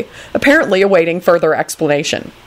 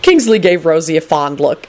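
ordinary delivery

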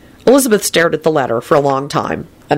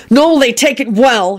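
shouting; 8 semitones higher than usual. A narrator's reading. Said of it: "Harry shouted as he leapt from his chair".

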